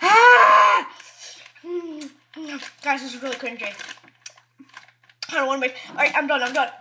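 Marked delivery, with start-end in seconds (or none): 0.0-0.9 loud burning yelp; pained shout. Real, not acted. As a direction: yell out from the heat. Mouth burning from hot chips.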